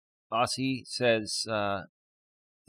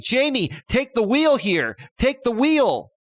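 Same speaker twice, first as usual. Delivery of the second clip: high, loud imitated appeal; mock urgent plea; 12 semitones higher, 6 dB louder, slower than usual. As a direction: call out a mock plea, drawing out the words. Voicing her urgent plea to Jamie.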